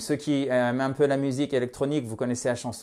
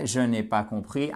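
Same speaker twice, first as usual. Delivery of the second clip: ordinary talk, nothing marked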